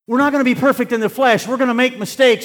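high, loud preacher's exhortation; impassioned sermon at full volume; full-force preaching. Real, not acted. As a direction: preach it hard and high to the whole congregation.

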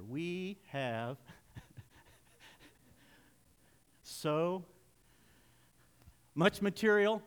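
drawn-out words with long pauses between; reading slow. Shown as a demonstration. Preacher giving Robbie time to get up here, reading very slowly. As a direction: stretch each word and wait, stalling playfully. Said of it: "I'll read slow".

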